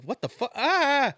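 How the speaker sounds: laughing, then a high startled cry; shocked, then jump; shocked yelp. Retold or imitated. Retelling his own shock with a mock yelp.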